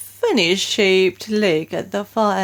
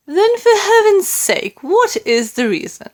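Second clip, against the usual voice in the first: impatient queen; 12 semitones higher, 5 dB louder than usual. A narrator's reading. Queen demanding an answer.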